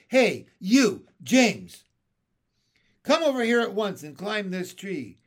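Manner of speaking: loud commanding yell; yelling at the boy. Acted. Sponge yelling at James to climb, loud. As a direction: bark James over to the tree.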